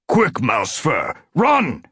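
urgent command jumping high on the last word; battle order, rising to a cry. A narrator's reading. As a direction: order a comrade to flee. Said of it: "he ordered".

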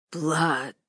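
put-on dry wheezing old man; papery voice. A narrator's reading. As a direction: rasp the word out, short of breath. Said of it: "wheezed the old man".

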